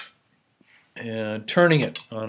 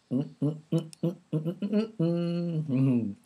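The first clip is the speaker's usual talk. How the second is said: savoring hums; 6 semitones higher, 5 dB quieter than usual. Real, not acted. Savoring a bite of pork.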